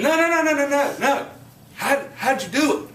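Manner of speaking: high, loud, rapid-fire protest; retold professor cutting in, loud and high-pitched; insistent disbelief. Baffled professor demanding an answer from the student, acted insistent disbelief.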